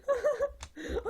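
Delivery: high, loud burst of laughter; real high-pitched giggling fit; laughing. Real, not acted. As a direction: crack up at your own silliness. Cracks up at own performance.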